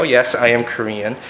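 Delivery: ordinary talk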